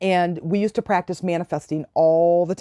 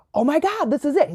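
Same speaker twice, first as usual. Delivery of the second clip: excited realization; 8 semitones higher than usual. Retold or imitated. Third red toy arrives.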